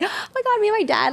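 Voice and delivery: high thrilled gasp of joy; excited squeal. Retold or imitated. Daughter reliving joy at seeing her dad, excited squeal. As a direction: squeal it like a delighted kid.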